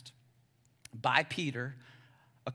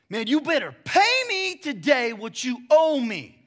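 louder, higher, slow threatening yell; angry yell; 8 semitones higher, 11 dB louder than usual. Acted. Servant choking his debtor, yelling angrily. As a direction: demand the money now. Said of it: "began to yell at him".

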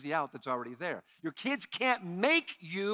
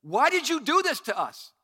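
higher and louder: why did you do this to us; a mother hurt and blaming; mock accusing mother; 7 semitones higher, 8 dB louder than usual. Greek rendering voiced as Mary.

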